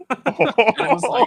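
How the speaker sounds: high laughing lead-in. Real, not acted. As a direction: giggle through it, delighted. Laughing, gushing about fan.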